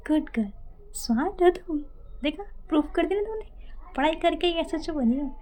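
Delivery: sugary sarcastic compliment; sarcastic praise. Retold or imitated. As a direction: pat her on the head sarcastically.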